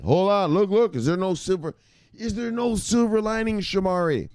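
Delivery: high put-on voice of an interrupting viewer; pleading viewer.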